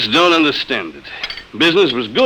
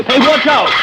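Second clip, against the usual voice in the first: alarmed shout; 9 semitones higher than usual. Acted. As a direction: shout as the coffee spills. Robot spills coffee on him.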